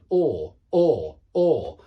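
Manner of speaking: "or" drawn out and loud; long or vowel. Shown as a demonstration. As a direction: hold the "or" sound. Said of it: "the central sound is an or".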